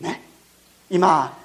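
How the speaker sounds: ordinary talk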